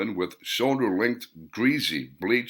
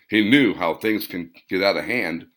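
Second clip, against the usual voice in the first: plain reading voice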